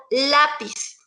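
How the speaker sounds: "lápiz" stressed on the first syllable, LÁ. Shown as a demonstration. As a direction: set "lápiz" apart, leaning on its A. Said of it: "La A es la que tiene el acento". ("lápiz" con énfasis en la A)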